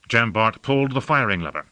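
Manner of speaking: ordinary delivery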